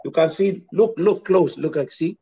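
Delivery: Dominican accent impression. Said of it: "you sound just like one of them".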